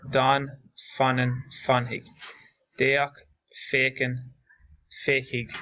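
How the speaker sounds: slow, careful listing of Irish verb forms; steady teacher's drill, each form set apart by pauses; pronunciation drill. Shown as a demonstration. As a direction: model each Irish word clearly for the class to copy.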